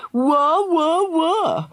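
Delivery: high, jeering fake wails; mock crying. A narrator's reading. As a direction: mimic her crying to taunt. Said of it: "The waterworks are off now, sneered".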